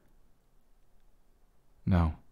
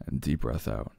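soft guided exhale; 7 semitones lower, 6 dB quieter than usual. Shown as a demonstration. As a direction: breathe the words out gently like a coach. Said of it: "let's do a little bit of a breath exercise".

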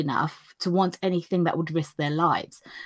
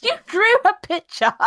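much higher, sing-song mock delight; teasing mockery; 4 dB louder than usual. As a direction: poke fun like giggling schoolgirls. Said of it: "the other girls find this hilarious".

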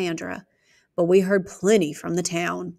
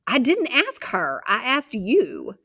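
protest; 4 semitones higher, slower than usual. Acted. Child protesting to father.

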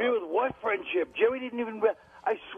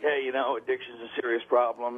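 lower, mock-serious public message; earnest message; 6 semitones lower than usual. Comic mimics the message at the end of the act, mock-earnest. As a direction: lightly parody a solemn moral at the end of an act. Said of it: "there was also a message".

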